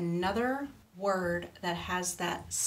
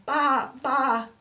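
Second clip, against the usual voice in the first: loud, high baa-baa; sheep's bleat; 6 semitones higher, 8 dB louder than usual. A narrator's reading. Storyteller voices a sheep for children, loud bleat. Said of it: "bleated the sheep".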